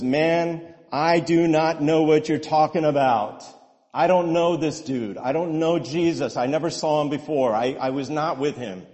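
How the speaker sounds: loud, pleading protest; desperate denial. Acted. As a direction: insist you never knew him. Preacher acts Peter's frightened denial.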